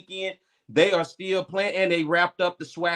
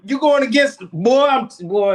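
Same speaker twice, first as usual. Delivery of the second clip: raised, louder disbelief; loud mock outrage; 6 semitones higher, 8 dB louder than usual. Real, not acted. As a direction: call him out loudly.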